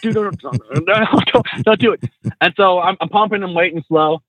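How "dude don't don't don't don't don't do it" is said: urgent plea. Retold or imitated. Retold urgent plea to his student.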